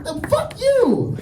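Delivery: high, drawn-out outraged retort; hammed-up indignant cry, stretched out; freaking out. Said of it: "You gotta stop freaking out".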